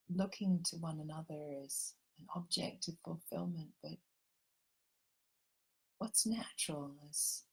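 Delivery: gentle meditation guide drawing everyone into the heart; guided meditation. Acted. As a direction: ease the listeners into calm, unhurried.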